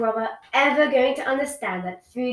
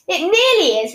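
retort; 7 semitones higher, 8 dB louder than usual. Acted. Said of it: "retorted Ivy".

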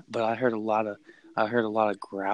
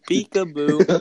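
higher, louder mock intruder voice; taunting peekaboo; 6 semitones higher, 8 dB louder, slower than usual. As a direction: call out a creepy peekaboo taunt. Intruder's taunt while creeping round the door.

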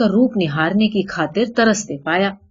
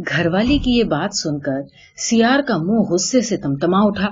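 ordinary delivery

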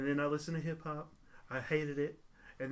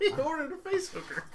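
mocking voice; 13 semitones higher, 4 dB louder than usual. Teasing friend's slip.

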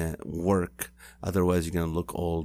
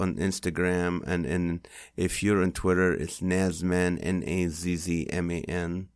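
normal talking voice

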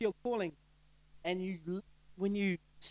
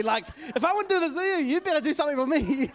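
higher, quick, cheeky tit-for-tat demand; mock demanding attitude; 8 semitones higher, 8 dB louder than usual. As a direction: play the husband bargaining, half joking. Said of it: "that's the attitude".

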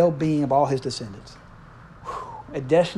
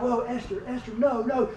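soft, pitched-up mock begging; a hammed-up plea, much softer; mock pleading; 5 semitones higher, 11 dB quieter than usual. Preacher voicing Haman begging Esther, soft mock plea.

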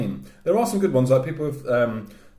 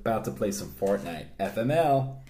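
put-on American accent; 2 semitones lower, 4 dB quieter than usual. Telling the neighbour about Fortnite, light American accent. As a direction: lightly mimic an American gamer. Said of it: "all of these Twitter exchanges are American".

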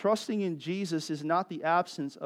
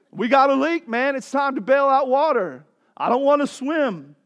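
urgent disciple; 9 semitones higher, 9 dB louder than usual. Retold or imitated. Disciples bailing water in storm.

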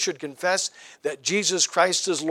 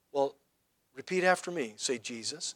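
very soft, low bedtime voice; comforting parent; 4 semitones lower, 10 dB quieter than usual. Retold or imitated. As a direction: hush a frightened child, re-enacted. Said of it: "as trying to comfort them".

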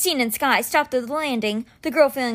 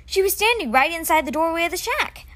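growing alarm; 6 semitones higher than usual. A narrator's reading. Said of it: "alarm growing in his voice".

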